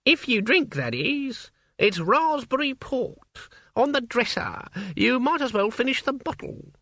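put-on high, crackly old woman; old witch voice. A narrator's reading. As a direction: play an elderly witch, brisk. Witch voice in narration.

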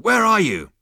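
very high, very loud yell; calling out. A narrator's reading. Zaphod calling after the vanished voice, full shout. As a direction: yell it into the distance. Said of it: "called out Zaphod".

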